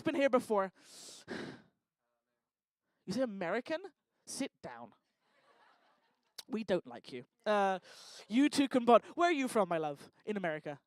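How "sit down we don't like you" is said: much lower, softer put-down; mock dismissal. Host dismissing an American guest, mock dismissal. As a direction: drop right down in pitch for a mock rejection.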